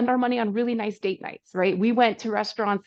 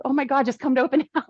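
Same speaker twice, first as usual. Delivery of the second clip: fast, giggly retelling of the husband; rushed laughing quote; 4 semitones higher, 3 dB louder, much faster than usual. Retold or imitated. Husband urging his wife to come to the open house, fast and laughing. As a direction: blurt it out quickly through a laugh.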